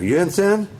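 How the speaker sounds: sharp loud threatening question; loud demand. Acted. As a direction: bark it as a threat. Acting out a mob threat.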